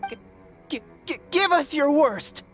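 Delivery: scared but defiant, tripping on the first sound; shaky start, then bold dare; stammering defiance. Acted. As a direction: stutter out a brave challenge.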